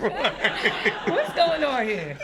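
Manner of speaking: slow, laughing question. Real, not acted. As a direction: laugh it out slowly.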